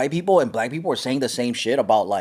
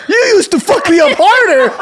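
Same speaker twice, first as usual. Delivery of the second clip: high, loud mock yelling; hammed-up shouted retort, half laughing; indignant dad; 14 semitones higher, 8 dB louder than usual. Dad's retort, acted out for laughs.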